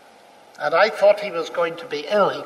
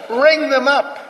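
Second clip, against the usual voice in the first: louder, higher, insistent order; firm command; 7 semitones higher, 5 dB louder, slower than usual. Retold or imitated. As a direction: repeat it as an irresistible command. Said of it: "one of those irresistible commands".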